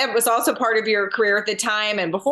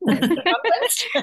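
mock gasp; 9 semitones higher than usual. Mock reaction to wearing pink.

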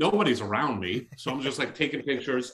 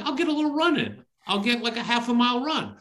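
higher and fast, keen to go; pep yourself up for a quick jog; eager self quote; 8 semitones higher, faster than usual. Retold or imitated. Quoting his own plan to jog to Julian, eager and quick. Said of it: "I was like".

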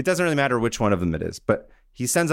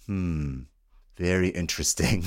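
pondering interest; 6 semitones lower, 5 dB quieter than usual. Retold or imitated. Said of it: "he kinda looks and he's like".

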